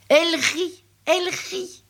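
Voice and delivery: montée très aiguë sur le rire; rire joyeux. A narrator's reading. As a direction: fais monter la voix comme un rire. La fillette rit. (raconte le rire, voix très haute)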